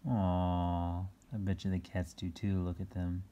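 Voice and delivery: usual speaking voice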